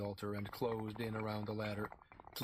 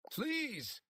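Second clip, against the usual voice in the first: much higher pleading; begging. A narrator's reading. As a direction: beg the woman to make room. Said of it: "Pete begged".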